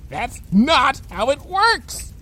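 slow, high-pitched retort; high, drawn-out frustration; exasperated. Acted. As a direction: stretch the words in exasperation.

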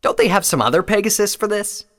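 high, loud complaint; protesting Scarlet. A narrator's reading. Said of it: "Scarlet protested".

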